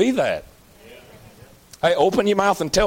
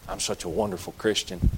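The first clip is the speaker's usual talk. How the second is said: mock pious boast; 9 semitones lower, 8 dB quieter than usual. Preacher mocks boastful churchgoer.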